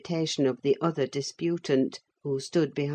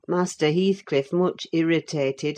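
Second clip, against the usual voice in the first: usual speaking voice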